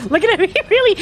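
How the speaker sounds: ordinary talk, nothing marked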